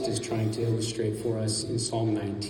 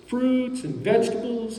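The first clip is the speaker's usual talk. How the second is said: imitated vendor call; 10 semitones higher than usual. Street vendor advertising produce to passers-by, mock yell. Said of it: "yelling with their little megaphones".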